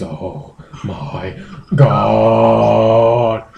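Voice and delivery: mock-anguished, hugely drawn-out Oh; drawn-out oh my god. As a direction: stretch the Oh out endlessly, then my God. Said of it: "About twenty, twenty-five O's".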